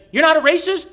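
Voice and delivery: mock accuser. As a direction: squeak it in mock disbelief. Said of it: "He's like".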